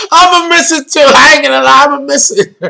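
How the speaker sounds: real laugh through the words, pitched high. Real, not acted. Host telling viewers he'll miss the series, laughing. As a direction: laugh while admitting it, voice lifting high.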